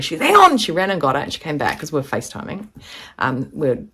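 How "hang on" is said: high, loud imitated "hold on" shout; calling out. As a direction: imitate a friend yelling before dashing off. Retold friend dashing off mid-call.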